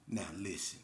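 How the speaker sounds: ordinary talk, nothing marked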